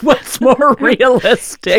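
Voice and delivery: slow, laughing through words. Real, not acted. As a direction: giggle while repeating it. Repeating the kid's question, amused.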